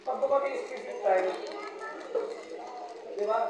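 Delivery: ordinary talk, nothing marked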